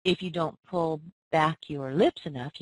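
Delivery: ordinary talk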